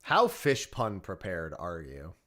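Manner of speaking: ordinary delivery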